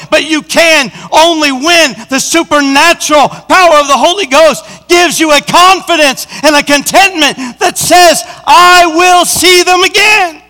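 impassioned sermon climax; full-force preaching. Acted. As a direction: build to a triumphant declaration.

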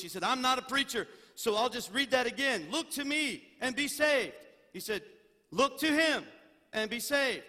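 thundering pulpit scripture reading; preaching at full force. Acted. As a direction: proclaim the verse over the congregation. Preaching scripture, loud and raised.

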